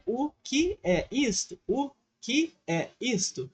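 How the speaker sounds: slow, over-articulated O que é isto; slow careful full form. Shown as a demonstration. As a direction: spell out every word of O que é isto. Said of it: "a gente nunca fala assim".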